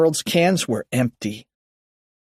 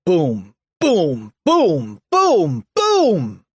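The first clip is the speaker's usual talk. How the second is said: booming footsteps; 5 semitones higher, 3 dB louder, much slower than usual. A narrator's reading. Mister Krupp running down hallway, rising booms. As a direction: separate falling booms, climbing in pitch.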